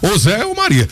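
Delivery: ordinary delivery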